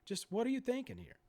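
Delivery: normal talking voice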